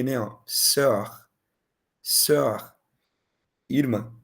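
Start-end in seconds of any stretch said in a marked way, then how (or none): 0.5-2.8 sœur said as an o blended with e, rounded lips; French œ vowel. Shown as a demonstration. Teacher models sœur's rounded vowel for students. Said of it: "Meio que um O com um E".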